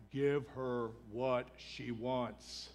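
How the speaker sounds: very slow, low, weighted words; emphatic exasperation. Retold or imitated. Fed-up disciples telling Jesus to act, slow exasperated demand. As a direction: spell it out slowly and heavily, word by word.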